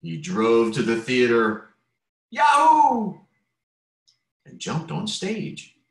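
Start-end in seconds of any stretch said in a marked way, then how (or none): 2.3-3.2 high, loud whoop; whooping yahoo. Acted. Frank cheering on his way to the theater, gleeful whoop.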